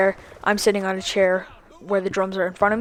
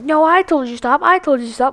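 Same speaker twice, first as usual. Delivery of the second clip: higher-pitched mocking imitation; mimicked indignant protest; 5 semitones higher than usual. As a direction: insist like the girl in the quarrel, exaggerated. Mimics the girl arguing back.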